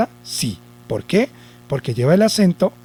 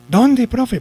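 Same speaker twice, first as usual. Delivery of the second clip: high-pitched pupil calling to the teacher; high, imitated schoolkid asking; pupil's question; 9 semitones higher than usual. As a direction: pipe up like a student in class.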